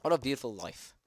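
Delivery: ordinary delivery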